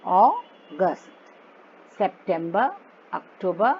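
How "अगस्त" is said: अगस्त drawn out as AW... GUST; very slow English August, अगस्त, with a long first vowel; slow syllable demo. Shown as a demonstration. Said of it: "क्या बोलेंगे इसको".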